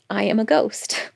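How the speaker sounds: hushed whisper of wonder; whispered quote. Retold or imitated. Ghost realizes her state, whispering softly. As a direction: breathe it out in awe. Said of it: "And she's like".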